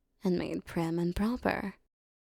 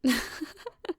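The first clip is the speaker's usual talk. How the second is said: high, light burst of laughter; teasing laugh; 7 semitones higher than usual. Acted. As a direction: laugh at a pet's desperate pleading. Teasing the pleading captive.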